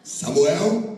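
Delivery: loud, rising call of the name. Acted. God calling Samuel a second time, loud rising call.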